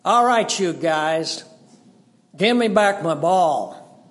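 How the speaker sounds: put-on loud and cocky kid; confident boy. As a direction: stand tall, daddy behind you. Boy returns backed by dad.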